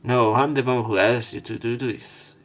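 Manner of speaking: normal talking voice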